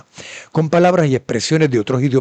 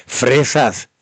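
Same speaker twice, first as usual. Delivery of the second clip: ordinary talk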